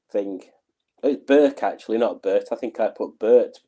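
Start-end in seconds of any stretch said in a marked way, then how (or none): none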